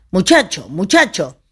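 lightly acted call to a boy. Old priest calling his young pupil, lightly acted calling out. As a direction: call out the name lightly, with swooping pitch. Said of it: "cuando lo llamaba le decía".

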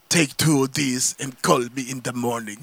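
low, slow, deadpan villain; Mr. Freeze pun. Retold or imitated. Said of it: "and he says".

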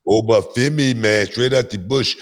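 put-on Jamaican accent. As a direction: hype him up in a jokey Jamaican accent. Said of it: "that's my Jamaican accent".

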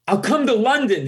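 high, flustered blurt; nervous blurt. Retold or imitated. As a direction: squeak it out before you can stop. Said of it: "I blurt out".

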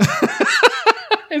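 sudden high squeal of a laugh; burst of high laughter. Real, not acted. Cracking up at his friend's clap joke, high squealing laugh. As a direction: let out a shrieking laugh.

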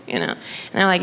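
usual speaking voice

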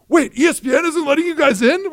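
high, loud astonished friend; mock shock at the door; surprised friend. Friend to the players, high mock surprise.

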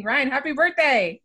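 real excitement, wishing her co-host a happy birthday; excited birthday wish. Real, not acted. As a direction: greet him like it's a real celebration. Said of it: "This is so exciting".

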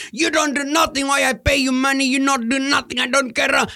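put-on angry old customer. Angry customer yelling at the pest control guy in a high voice. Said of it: "he just yelled and screamed at me".

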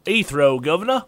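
loud Cockney catchphrase, pitch jumping up; Cockney accent. Acted. Co-host showing his only Cockney line, put-on Cockney. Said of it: "I can do w-, one Cockney".

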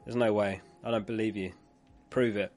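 usual speaking voice

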